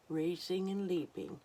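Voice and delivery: usual speaking voice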